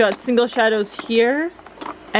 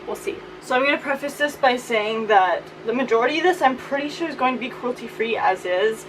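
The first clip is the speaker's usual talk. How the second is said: ordinary talk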